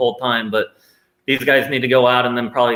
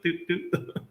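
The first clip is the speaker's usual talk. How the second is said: mock counter beep; 5 semitones higher, 10 dB quieter than usual. Imitating a counter ticking.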